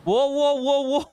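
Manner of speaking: loud, high repeated wows; mock amazement. Real, not acted. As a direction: react as if scandalised. Reacting to a senator insult.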